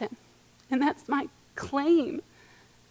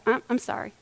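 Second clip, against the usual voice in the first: half-hearted sorry. Retold or imitated. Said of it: "Isn't it a lot easier to give up".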